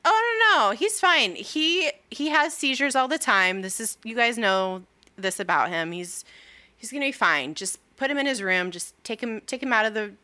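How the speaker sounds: nervously brushing it off; frantic cover-up. Retold or imitated. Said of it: "Nero's like".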